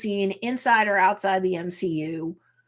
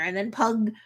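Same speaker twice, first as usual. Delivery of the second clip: usual speaking voice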